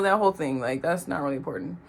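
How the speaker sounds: ordinary delivery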